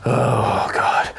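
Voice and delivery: hammed-up despairing shopkeeper, drawn out; slow, put-upon merchant voice; weary merchant. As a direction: groan it out, then go soppy. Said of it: "And he's just, like, sifting through".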